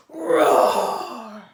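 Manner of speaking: very loud, throaty storybook roar; huge angry roar, falling away; big roar. Acted. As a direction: roar it with everything you have. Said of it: "She roars a red, red roar".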